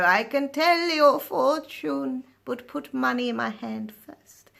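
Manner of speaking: slow, mystical fortune-teller voice. Retold or imitated. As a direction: draw it out like a caravan fortune teller. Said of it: "fortune teller in the caravan".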